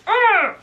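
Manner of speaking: high exasperated groan; annoyed groan at the wrinkled coat; frustrated ugh. Real, not acted. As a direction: let out a fed-up ugh.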